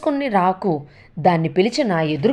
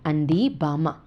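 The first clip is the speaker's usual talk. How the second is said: ordinary delivery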